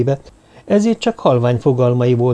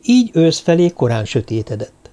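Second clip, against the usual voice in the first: ordinary delivery, nothing marked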